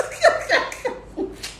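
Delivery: breathless high laugh; high airy laugh. Real, not acted. Laughs hard.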